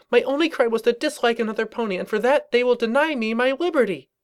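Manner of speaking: high, self-righteous pony rant; indignant young mare voice. Acted. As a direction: declaim it like a wronged martyr.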